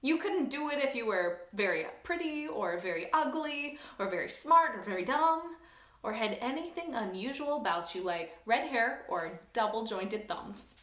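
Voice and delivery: usual speaking voice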